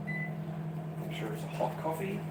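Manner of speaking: slow whisper to himself; whispered aside. Real, not acted. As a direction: murmur it under the breath. Aside while getting coffee.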